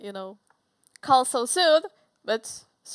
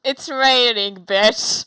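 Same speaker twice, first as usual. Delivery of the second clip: loud joking retort; 10 dB louder, much slower than usual. Real, not acted. Punchline after laughing.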